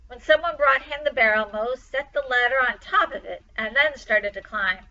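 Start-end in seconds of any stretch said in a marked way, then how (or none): none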